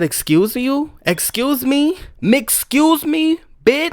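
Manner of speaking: indignant disbelief; outraged comeback. Retold or imitated. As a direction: call him out sharply. Confronting manager over slur.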